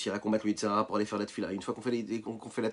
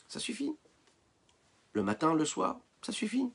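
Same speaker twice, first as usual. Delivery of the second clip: tempting inner voice; 9 semitones higher than usual. Acted. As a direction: coax it lightly, pitched up, as the tempter. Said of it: "Le Yetzer Hara".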